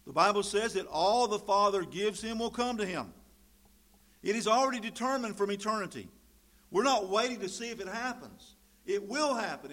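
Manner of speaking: loud, ringing pulpit voice; loud scripture reading that rolls into preaching; full-force preaching. Acted. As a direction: preach it out to the whole room. Preaching on promised gifts.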